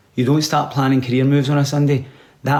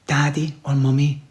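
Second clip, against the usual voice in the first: usual speaking voice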